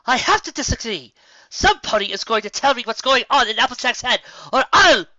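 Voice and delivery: a miffed princess insisting on answers; demanding. Acted.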